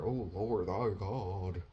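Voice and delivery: put-on lightly pompous old-English voice; mock King James. Preacher mocking churchy Shakespearean prayer, lightly pompous. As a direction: intone it stiffly like old scripture. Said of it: "thou and all of them Shakespeare words".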